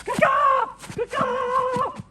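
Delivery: high, loud crow caw; bird call. Acted. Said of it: "bird call".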